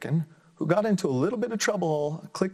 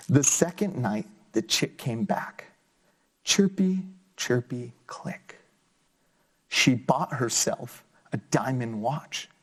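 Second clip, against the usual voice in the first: put-on lively, sing-song story reading for kids; reading to children; slower than usual. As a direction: bring the picture book alive for little listeners. Reads picture book aloud.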